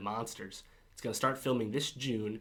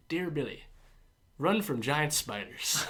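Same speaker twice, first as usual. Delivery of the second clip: slow, higher Southern drawl; 5 semitones higher, slower than usual. Retold or imitated. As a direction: drag it out like a Southern soldier. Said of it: "he has a southern drawl".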